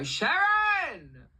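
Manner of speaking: high, loud drawn-out holler; imitating an exasperated boss calling out; boss's yell. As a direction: holler the name across a factory floor. Boss yelling across the factory.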